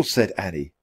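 usual speaking voice

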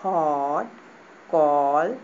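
हॉट and कॉल with rounded aw, low; English vowel demo. Shown as a demonstration. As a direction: model हॉट, कॉल for the class. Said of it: "तो ऐसे पढ़ेंगे".

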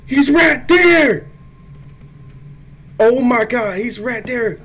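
loud, high panicked shout; panicking gamer. Real, not acted. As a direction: yell in terror. Monster spotted suddenly.

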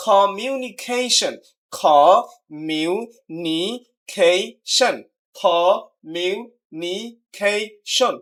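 Communication very slowly in five beats; syllable-by-syllable. Shown as a demonstration. Teacher showing five syllables, slow and deliberate. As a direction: spell out communication as co, mu, ni, ca, tion. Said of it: "এখানে পাঁচটা syllable রয়েছে".

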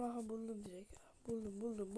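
excited whisper of discovery; whispering I found it. Real, not acted. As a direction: hiss the find to yourself. Excited find, said under breath.

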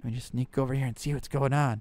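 hushed. Acted. Sneaking past the principal.

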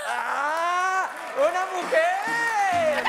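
drawn-out surprised shout. Acted. Reveal of contestant.

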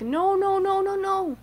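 high mock panic, repeated no; frantic Sir Topham Hatt. Railway boss stopping the engines, high mock panic. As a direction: stop it, playful alarm. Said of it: "Sir Topham Hatt's like".

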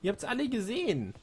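high excited gushing. Real, not acted. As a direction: squeal over how cute it was. Gushing about the creature weapon. (begeistert schwärmend)

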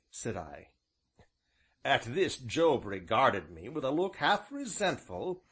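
usual speaking voice

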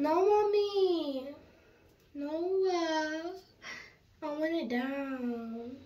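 high, slow whining plea; whiny. Real, not acted. Daughter begging mom to leave her hair down, whining.

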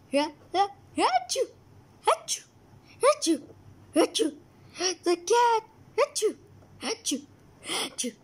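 loud sneezing fit. Acted. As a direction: sneeze over and over.

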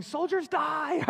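high, drawn-out mock battle cry; hammed-up warning yell; imitated yelling. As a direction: yell it long and high like a panicked kid. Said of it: "They kept yelling".

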